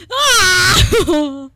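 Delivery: high, loud sobbing scream; tearful shriek; loud crying wail. Real, not acted. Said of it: "I'm crying a little bit".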